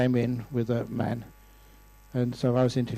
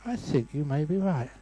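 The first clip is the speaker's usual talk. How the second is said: soft, tender husband giving in; gentle; 2 semitones higher, 7 dB quieter than usual. Acted. Husband soothing his tearful wife, gentle and quiet. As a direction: concede softly, with loving pauses. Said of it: "He squeezed her hand gently and said".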